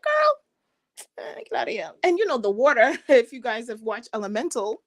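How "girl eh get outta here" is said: lightly voiced mother waving it away; dismissive mom. Earth-sign mom to her child, dismissive. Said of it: "because my mom was earth sign".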